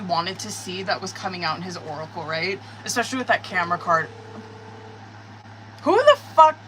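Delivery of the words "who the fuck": loud, high furious shout; angry outburst. Real, not acted. Reader interrupted by repeated calls, furious shout. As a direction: yell it at the ringing phone. Phone keeps ringing mid reading.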